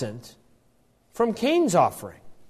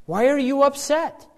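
gentle question; 8 semitones higher than usual. Acted. Preacher voicing God gently asking Cain why he is upset. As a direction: ask it kindly, not accusing. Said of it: "it's gently done".

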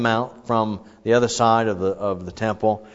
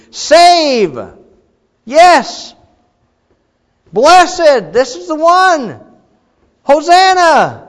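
very high, loud, drawn-out cries; ecstatic crowd; 12 dB louder, much slower than usual. Retold or imitated. Preacher acting out the crowd's frenzied cries of welcome. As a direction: shout each word like a frenzied crowd.

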